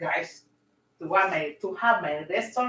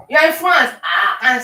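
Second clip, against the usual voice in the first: loud, high surprise at the answer; surprised exclamation; 4 semitones higher, 10 dB louder than usual. Real, not acted. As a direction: react in shock to a comment. Surprised at viewer's comment.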